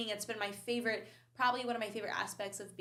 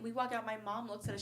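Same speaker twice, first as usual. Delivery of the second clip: ordinary talk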